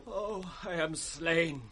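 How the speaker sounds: faint dying whisper; dying words. Acted. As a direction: let life drain from the voice. Dying servant's last words.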